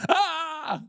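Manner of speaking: startled yelp. Retold or imitated. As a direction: yelp like a man in panic, but softly.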